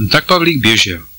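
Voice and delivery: ordinary delivery, nothing marked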